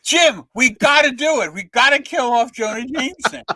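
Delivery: high, eager retold plea; pushing the idea with excitement; eager pleading. Writer begs the editor to kill off Jameson, eager. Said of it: "I'm jumping up and down".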